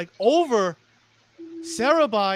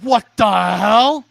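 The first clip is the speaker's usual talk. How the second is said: loud, slow, stretched disbelief; stunned shout; 10 dB louder, slower than usual. Acted. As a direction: let the shock hit slowly and loud. Commentator reacts to spiked stick.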